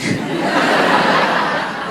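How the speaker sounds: breathy silent laugh; voiceless laugh. Real, not acted. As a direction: laugh with no voice at all. Laugh after realizing damage.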